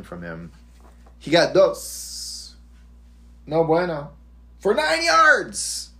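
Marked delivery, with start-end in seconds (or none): 1.2-4.1 mock Spanish accent. Mock Spanish, slow and high. 4.6-5.9 shrill squeal of disbelief; outraged high-pitched cry. Pretend.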